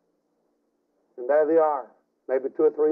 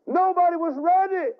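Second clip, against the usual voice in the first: preaching climax. Acted.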